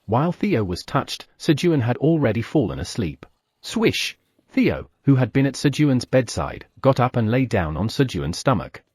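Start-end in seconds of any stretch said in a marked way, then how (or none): 3.6-4.2 high whooshing swish; quick sound-effect swish. Acted.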